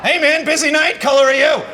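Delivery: high, loud hail to a cabbie; mock cheery greeting. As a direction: call it out to a taxi driver. Acted greeting to a taxi driver.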